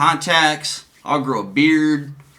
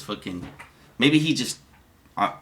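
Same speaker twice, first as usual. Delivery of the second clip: usual speaking voice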